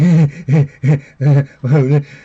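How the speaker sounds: loud dopey cartoon chuckle; chugging, imitated idiot laugh, loud; Beavis and Butthead laugh. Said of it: "it's like Beavis and Butthead".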